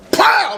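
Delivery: yelped "Pow!" up high like a hit landing; high mock impact cry. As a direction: shout the impact noise. Acting out the hit.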